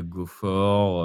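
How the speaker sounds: usual speaking voice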